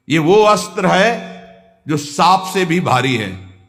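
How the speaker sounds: ordinary delivery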